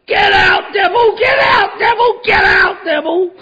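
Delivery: high, very loud desperate yell; screaming at the devil. Retold or imitated. Recovering addict screaming at his inner devil, loud and high.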